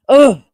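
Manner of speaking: loud exasperated "ugh"; frustrated groan. Real, not acted. Fan venting about falling asleep in the first quarter, frustrated groan. Frustrated at the team's slow start.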